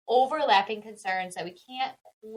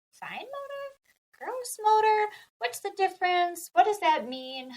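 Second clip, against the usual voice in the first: puzzled pondering; 10 semitones higher than usual. Retold or imitated. Therapist still looking up motor terms, puzzled. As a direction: puzzle it out aloud in a higher voice.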